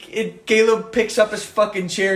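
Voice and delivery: ordinary talk, nothing marked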